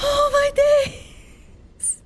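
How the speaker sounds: high, loud and very slow disbelieving cry; overwhelmed cry. Real, not acted. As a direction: stretch it out in shock and joy. Stunned by the win.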